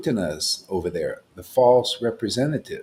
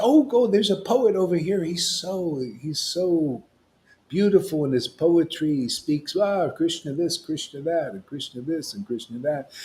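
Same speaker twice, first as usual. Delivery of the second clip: gushing devotee; 7 semitones higher than usual. Retold or imitated. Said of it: "So Bhagavan Acharya said".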